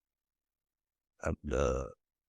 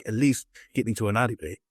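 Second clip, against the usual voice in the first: ordinary talk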